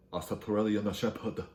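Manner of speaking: slow, put-on Korean accent; exaggerated Korean accent with extra vowels; mock Korean accent. Shown as a demonstration. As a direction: sound out each syllable in a mock Korean accent. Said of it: "you say it with Korean accent".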